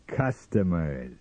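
slow, drawn-out savouring of CUSTOMERS; lingering. Acted. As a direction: linger on the word. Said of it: "he lingered on the word".

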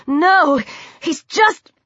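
high, cut-off denial; scared, urgent interruption; frightened protest. A narrator's reading. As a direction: blurt it anxiously, pitch rising. Victim protests to attacker.

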